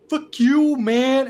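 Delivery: loud, high-pitched curse; angry shout. Acted. Bully fallen in the food, shouting angrily. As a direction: lash out at full volume. Humiliated bully swears at the player.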